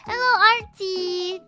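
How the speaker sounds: normal talking voice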